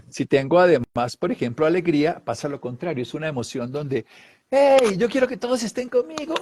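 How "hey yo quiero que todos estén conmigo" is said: high, bright-eyed call to everyone; joyful call. Acted. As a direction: invite everyone in, beaming. Ejemplo de alegría. (llamado alegre y agudo)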